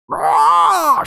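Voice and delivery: high, loud squawking roar like a broken chicken; terrible dragon roar. Retold or imitated. Dragon noise again.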